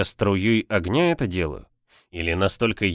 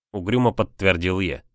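ordinary delivery, nothing marked

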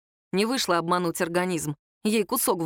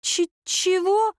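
high, slow, offended stammered question; huffy, high-pitched disbelief; pouty stammer; 12 semitones higher, 3 dB louder, much slower than usual. A narrator's reading. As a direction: pout and stumble on the word. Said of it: "надула губы Наденька".

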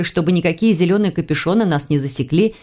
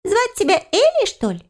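creatures asking together; 14 semitones higher than usual. A narrator's reading. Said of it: "оба в один голос спросили".

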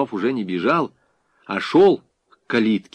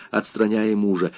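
ordinary delivery

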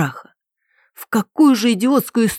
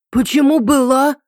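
high, drawn-out alarmed question; a touch of fear, slowed and raised; frightened; 7 semitones higher than usual. A narrator's reading. Ритка hears she may be endangered, mildly frightened. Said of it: "испугалась за себя Ритка".

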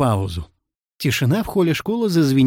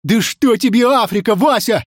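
high, heated interruption; impatient, raised-voice retort; agitated outburst; 9 semitones higher, 4 dB louder than usual. A narrator's reading. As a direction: jump up and object hotly. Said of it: "вскочил с дубовой скамьи Иван-Царевич".

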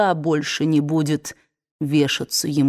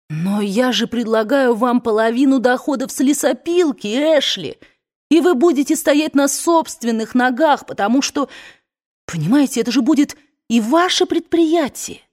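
higher, louder, eager insisting; eager young woman; 7 semitones higher, 4 dB louder than usual. Acted. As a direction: press the offer eagerly.

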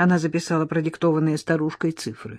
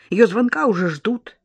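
plain reading voice

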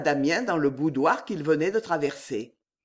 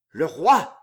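growled; 4 dB louder than usual. A narrator's reading. Servant shocked at talk of opposing the king, growling. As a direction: growl it out as the colour drains away. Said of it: "gronda Damien en pâlissant".

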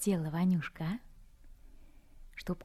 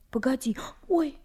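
sudden higher, louder alarm; startled; 7 semitones higher, 7 dB louder than usual. Acted. Anka startled, hearing someone at the door. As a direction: jump at a sound, alarmed.

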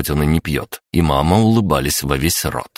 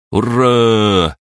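loud, high cheer; 7 semitones higher, 6 dB louder than usual. A narrator's reading. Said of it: "громогласным возгласом поддержали".

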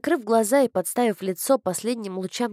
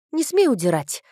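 ordinary delivery, nothing marked

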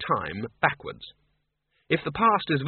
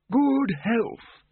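put-on high creaky old man's voice; chirpy doddering wizard; high thin old man, chipper; old Windle; 11 semitones higher than usual. A narrator's reading. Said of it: "said Windle Poons".

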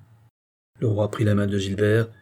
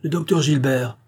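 raised, gladly surprised greeting; mildly delighted, higher-pitched call; joyful exclamation; 4 semitones higher than usual. A narrator's reading. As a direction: greet him with cheerful recognition. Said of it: "s'écria-t-il tout joyeux".

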